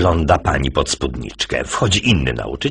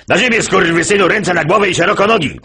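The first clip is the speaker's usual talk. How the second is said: police command; 12 semitones higher than usual. Acted. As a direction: yell it like a raiding cop. Police command as dog sound.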